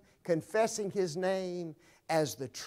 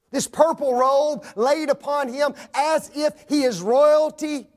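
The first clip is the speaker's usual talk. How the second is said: raised, loud pulpit voice proclaiming scripture; full force preaching; 9 semitones higher, 8 dB louder than usual. Acted. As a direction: ring it out to the back pews. Preacher paints scourged Jesus.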